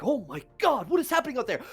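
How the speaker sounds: mildly startled, pitch climbing high; alarmed guard. Acted. Guards look toward the commotion, mildly alarmed. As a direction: react with a touch of shock.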